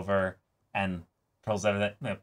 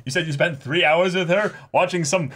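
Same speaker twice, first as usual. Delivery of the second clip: high, loud mock outrage; mock indignant father; 9 semitones higher, 5 dB louder than usual. Dad acting outraged that the boyfriend snubs his daughter, high and loud. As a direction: ham up an offended dad. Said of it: "They both are like".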